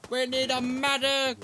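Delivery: shouting for a medic. Acted. Calling for medic in combat.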